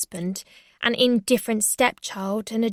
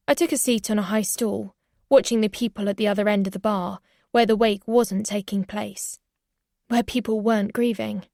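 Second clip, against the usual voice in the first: plain reading voice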